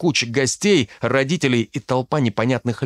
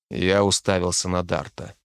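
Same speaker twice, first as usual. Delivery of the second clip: usual speaking voice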